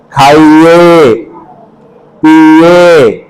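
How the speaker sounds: slow polite demo. Shown as a demonstration. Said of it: "ऐसे अच्छे respect बनना".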